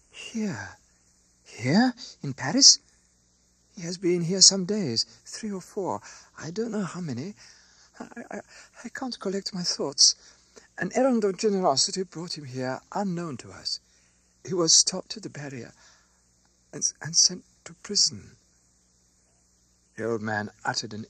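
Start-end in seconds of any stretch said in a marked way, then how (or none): 0.1-0.8 small, soft, falling voice; distraught answer. A narrator's reading. Distraught wife answers alarmed friend. 1.4-2.8 loud, rising, incredulous question; shocked echo. A narrator's reading. Friend echoes her answer, shocked. 3.7-18.4 high, halting, stammering account; distraught. A narrator's reading. Distraught wife explains husband's arrest.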